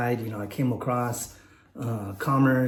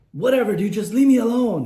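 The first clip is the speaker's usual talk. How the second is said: high, loud brush-off; retold exasperation; 10 semitones higher, 8 dB louder than usual. Beaten kid to his bully on the walk home, exasperated. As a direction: imitate a fed-up kid, voice raised high.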